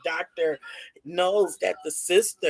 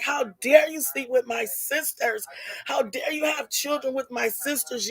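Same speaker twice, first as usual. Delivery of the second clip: higher-pitched mock tirade, not shouted; retold cussing out; 6 semitones higher than usual. Youngest sister confronting the father in the hallway, mock angry. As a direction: imitate an indignant sister telling someone off. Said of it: "she's cussing them out in the hallway".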